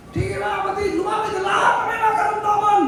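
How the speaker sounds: shouting out to the crowd like an evangelist; preacher's call. Retold or imitated. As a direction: call the sick forward at full voice. Evangelist calling crowd at crusade.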